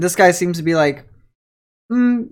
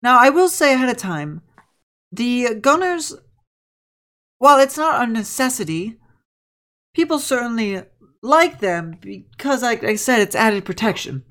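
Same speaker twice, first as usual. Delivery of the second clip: higher, prim and polite professor voice; a courteous school teacher, pitched up; professor lady; 8 semitones higher than usual. Acted. Professor lady welcoming the adventurers, higher and polite. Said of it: "He goes".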